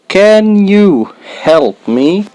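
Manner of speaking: "Can you help me?" drawn out slowly; slow careful model. Shown as a demonstration. Teacher to beginners, slow clear model. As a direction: model each word slowly for learners. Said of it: "slow then natural take".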